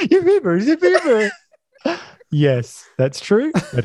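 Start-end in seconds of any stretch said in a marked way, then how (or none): 0.1-1.3 mocking Jack. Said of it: "And then he goes".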